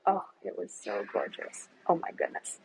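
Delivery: usual speaking voice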